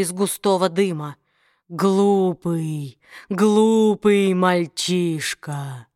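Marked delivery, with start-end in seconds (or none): 1.7-5.9 low, slow demonic hiss. Acted. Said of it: "прошипел Аграмон".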